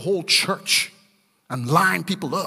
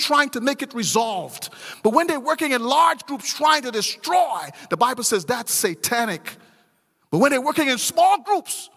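higher, louder sing-song preaching cadence; full-force preaching; 8 semitones higher, 3 dB louder than usual. Acted. Preacher driving home a point to his congregation, in a sing-song full-force preaching voice. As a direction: preach it out, ringing and rising.

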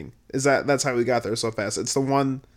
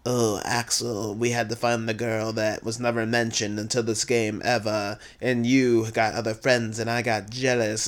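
Saïx; 4 semitones lower, 5 dB quieter than usual. Retold or imitated. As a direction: imitate a jealous rival confessing, softly. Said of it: "Saïx is like".

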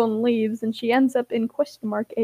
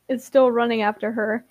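plain reading voice

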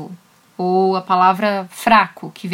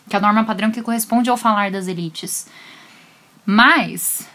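ordinary talk, nothing marked

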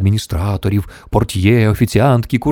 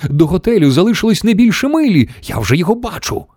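high-pitched urgent report; crying out; 11 semitones higher than usual. A narrator's reading. Shanks already sees the hotel ahead, crying out. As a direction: call out the warning. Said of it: "крикнув Шанкс".

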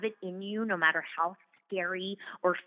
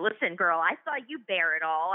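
ordinary talk, nothing marked

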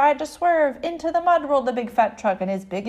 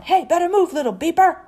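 loud, raised sweeper holler; 5 semitones higher, 7 dB louder than usual. A narrator's reading. Street sweeper to little truck, hollering. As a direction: holler it to clear the way. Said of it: "big street sweeper hollering".

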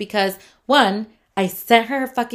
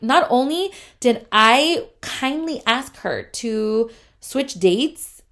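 normal talking voice

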